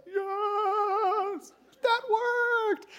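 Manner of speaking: high, drawn-out squeal of victory; gleeful triumph. Acted. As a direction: celebrate privately in a squeaky voice. Jacob secretly celebrating his win.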